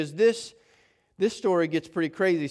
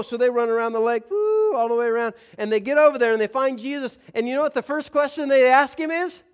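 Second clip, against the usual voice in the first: louder, higher, building; excited storytelling; 7 semitones higher, 6 dB louder than usual. A narrator's reading. Pastor tells congregation the chase, excited. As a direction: rush the story along.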